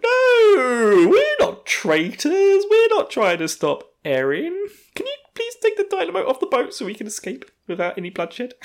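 forced innocent denial. Retold or imitated. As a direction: squeak it through a forced grin.

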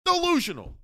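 very high, falling, fed-up yell; hammed-up weary Paulie; exasperated mock shout. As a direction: belt it like the hundredth take. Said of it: "Fucking director".

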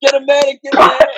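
mock battlefield shout, rising; calling for a medic. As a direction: yell for a medic, hammed-up.

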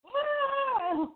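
high squealing laugh; laughing hard; burst of laughter. Real, not acted. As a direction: crack up at the impression. Laughing after imitation.

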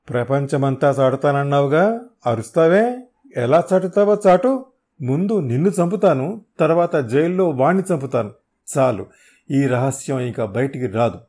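high, loud enraged threats; furious yell. Acted. Hero holding a lighter over the thug, furious yelling. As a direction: scream death threats.

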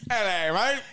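quick mock Australian greeting; lightly put-on Australian accent. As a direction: toss it off in a jokey Australian accent. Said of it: "don't think you can do their accent".

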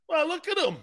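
high-pitched marvelling; retold admiring insistence. Grandfather insisting everyone admire Franco, high marvelling. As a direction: pitch it up, urging a look.

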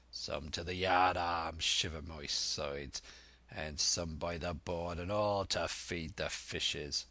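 put-on dangerous old sea dog, unhurried; Silver, menacing.

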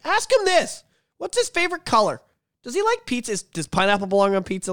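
high-pitched mock-eager questions; needling, high-voiced joke questions, easing off at the end; obnoxious heckling. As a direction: pester them in a silly high voice. Being an asshole about it.

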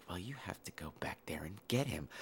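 hushed conspiratorial whisper; mock whisper. Orphanage boss whispering orders to the handyman, mock whisper. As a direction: whisper it like a scheming matron. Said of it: "And she's like".

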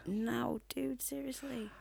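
slow whispered disbelief. Real, not acted. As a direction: breathe it in disbelief. Disbelief at the cheesy pun.

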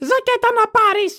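high, nagging mother's call; grandma scolding. Acted. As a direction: call after him like a worried mother. Greek mother calling son. (φωνή Ελληνίδας μάνας που φωνάζει)

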